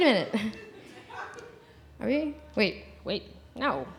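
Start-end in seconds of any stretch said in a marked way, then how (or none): none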